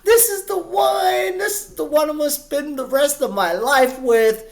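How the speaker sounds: very high, swooning mock-lovesick voice; lovestruck teenager. Imagining a fifteen-year-old finding first love, hammed-up lovestruck gushing. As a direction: gush dreamily like a teen in first love. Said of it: "you're just so in love".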